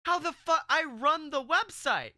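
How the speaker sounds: loud, high incredulous outburst; exasperated rant. Retold or imitated. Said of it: "And I'm like".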